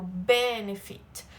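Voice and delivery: benefit slow, strong first syllable, "fit" dying away at the end; stress on BE. Shown as a demonstration. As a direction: punch the "be" of benefit and let "fit" fade. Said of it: "ele morre no final". (benefit com "be" forte e "fit" morrendo no final)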